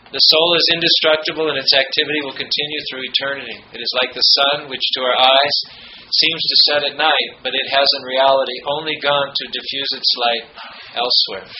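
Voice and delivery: steady, earnest read-aloud; formal quote reading. Acted. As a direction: read it out like scripture from the pulpit. Reads Goethe quote aloud.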